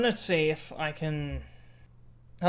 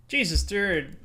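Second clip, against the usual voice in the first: ordinary delivery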